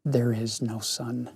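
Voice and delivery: soft, low and hushed. Acted. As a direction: drop the voice, hush it. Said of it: "in a softer, deeper voice".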